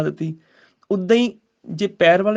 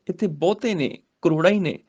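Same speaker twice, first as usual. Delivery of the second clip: ordinary talk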